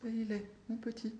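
high, soft, plaintive call; poignant. A narrator's reading. Grandmother to her departing son, poignant call. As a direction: call after him tenderly, high and faint. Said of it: "la grand-mère d'une voix poignante".

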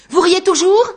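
loud and high, rising in rage; furious challenge. Acted. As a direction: spit it at him, enraged. Anguste hurls Texel at wall. (Anguste furieux, le saisissant au col)

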